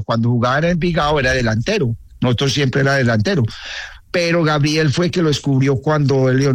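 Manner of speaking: ordinary talk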